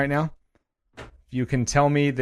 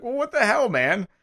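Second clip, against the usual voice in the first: higher, indignant voice for the juggalos; mock juggalo outrage; 11 semitones higher than usual. Imitating offended fans to the host. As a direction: play an offended fan. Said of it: "They're like".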